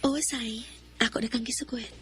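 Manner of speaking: breathy confessing whisper. Acted. Shai admits to Sai she likes him, whispering. Accepts suitor.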